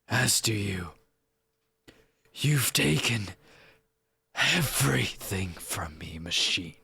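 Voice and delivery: brooding edgelord angel, bitter in defeat. Acted. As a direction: menace the foe in measured phrases with long pauses. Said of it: "I'm gonna just try to do edgelord voice".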